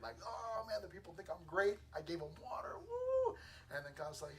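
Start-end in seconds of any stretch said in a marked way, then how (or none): 0.2-3.4 imitating a thrilled, self-pleased Moses; high start, ending in a soaring whoop; excited. Moses thinks the people find him great, excited. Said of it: "Moses is probably all excited".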